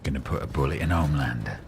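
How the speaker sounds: gruff East End try that drifts piratey; a lightly put-on London growl; half-hearted Butcher impression; attempted Butcher accent. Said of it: "See, when I do it, it comes out more like".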